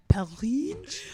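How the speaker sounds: Porridge said high with a rolled Scottish lilt; Scottish accent. Shown as a demonstration. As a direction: say Porridge in a broad Scottish brogue.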